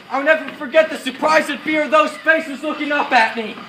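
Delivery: loud, high war recollection. Acted. Officer recalling his men's frightened faces, loudly. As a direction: still charged from the shouting.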